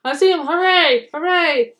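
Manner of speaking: high, loud triumphant tooting; jeering toots. A narrator's reading. Bertie the bus cheering as he spots the train. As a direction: crow in triumph from the hilltop. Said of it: "he jeered".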